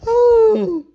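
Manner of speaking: high helpless laughter ending in a loud "ooh"; laughing fit. Real, not acted. Vlogger needing a minute after a random remark, laughing fit. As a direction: crack up, then hoot it out.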